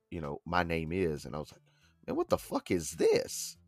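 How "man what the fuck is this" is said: higher-pitched mock-baffled question; retold bafflement. Friend recalling his first reaction to the song, baffled. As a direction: act out being thrown by what you hear.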